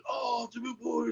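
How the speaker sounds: high, hushed mock yell; a big holler shrunk down to soft volume; quick, quiet imitation of yelling; retold full-out yell. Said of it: "as loud as I could".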